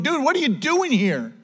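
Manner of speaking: high-pitched mock bafflement; mock incredulous. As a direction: ask it as if baffled to find him there. God voiced finding Elijah hiding.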